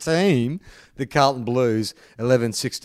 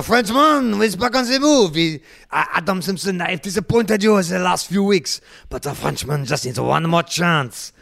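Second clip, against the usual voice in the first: high, hammed-up French accent; comic outrageous French accent pleading for one more chance; Mark Lacroix French accent; 3 semitones higher than usual. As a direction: ham up a French footballer begging his coach. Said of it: "will you insist on doing that accent".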